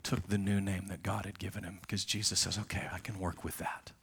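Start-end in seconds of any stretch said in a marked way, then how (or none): 2.6-3.9 gentle whispered acceptance; hushed, tender whisper. Acted. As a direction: breathe it out in a whisper. Jesus accepting Peter, softly.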